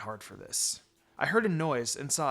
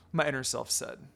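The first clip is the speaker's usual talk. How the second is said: ordinary delivery, nothing marked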